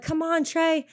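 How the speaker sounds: high, light cheer without volume; imitated cheering friend. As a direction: imitate a friend urging you on. Retold friend cheering her on.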